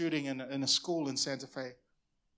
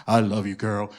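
low, louder crooning pickup line; smooth-talking dude; 9 semitones lower, 9 dB louder than usual. Retold or imitated. Pastor mocking guys who don't mean it, low crooning dude voice. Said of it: "some dudes that mean well say".

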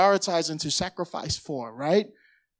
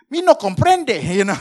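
high, loud mock Spanish with a laugh; 3 semitones higher, 6 dB louder than usual. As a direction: ham up a broken Spanish speaker. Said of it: "other one's like Spanish".

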